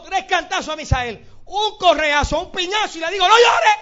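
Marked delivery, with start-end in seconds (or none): 3.3-3.8 put-on loud, high stern yell at the crying boy; retold yell. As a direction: bark it down at him like an angry father. Father hitting son, yelling. (grito severo de padre, alto y fuerte)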